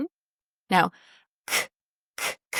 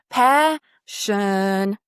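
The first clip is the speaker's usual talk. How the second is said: Passion with PA drawn out long; long first syllable; 2 semitones lower than usual. Shown as a demonstration. Coach modelling Passion for the student, PA held long. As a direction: linger on the PA in Passion. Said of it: "don't forget it's pa".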